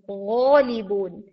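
गौलिबून slow with a voiced ghain; voiced ghain demo. Shown as a demonstration. Teacher showing the voiced ghain. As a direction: let the ghain buzz in गौलिबून. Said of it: "ये जहर हो रही है".

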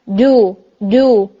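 ڈو said very slowly with a hard clean hit; strong jeem without air. Shown as a demonstration. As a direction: stop the air completely on each hit. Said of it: "اس میں ایئر نہیں ہے".

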